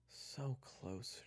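high, inward lament; exasperated thought. Acted. June despairs as the truce collapses, exasperated thought. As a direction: think it aloud in dismay. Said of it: "she thought".

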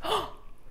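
sharp breathless scared gasp; mock-startled intake of breath; hammed-up fearful gasp; frightened gasp. Shown as a demonstration. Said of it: "What's your scared face?".

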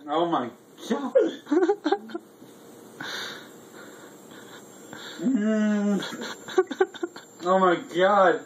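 high, strained moans in pain; pained moaning. Real, not acted. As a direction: moan through burning pain. Reacting to burning hot sauce.

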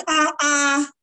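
high-pitched protest, quickening; mock protest. Acted protest to God's big request.

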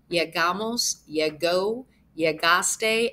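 usual speaking voice

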